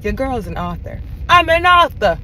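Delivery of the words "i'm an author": excited. Real, not acted. Repeats proud announcement.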